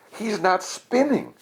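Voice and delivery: high, eager voice, pitched well up; Sammy cheering Ned toward the shoes; excited hope. A narrator's reading. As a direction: urge him on with growing belief. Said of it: "shouted Sammy".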